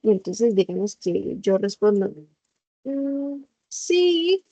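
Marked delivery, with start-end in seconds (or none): 2.8-4.4 a faint, hesitant "Mm" then a high "sí"; unsure. Shown as a demonstration. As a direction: sound like you are lying. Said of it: "Con ese tono de voz". ("Mm, sí" dudoso, poco convencido)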